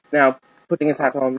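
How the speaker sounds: ordinary delivery, nothing marked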